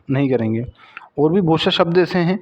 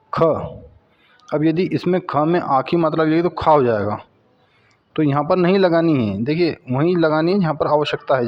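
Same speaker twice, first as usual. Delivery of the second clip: ordinary delivery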